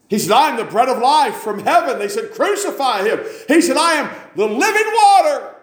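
high, loud, building preacher's cry; preaching at full force. Acted. Preacher crying out Jesus's words against the crowd, full force. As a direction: climb to a shouting crescendo.

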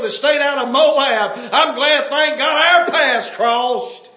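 high, loud revival-preacher shout; full-force preaching. Acted. Preacher retelling a widow's testimony to the congregation, full-force preaching. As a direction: drive it out high and loud from the pulpit.

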